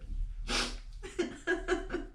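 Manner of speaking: high, voiceless laugh through tears; teary laugh. Real, not acted. Overcome while recalling her vow, teary laugh. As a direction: let out a long, crying laugh.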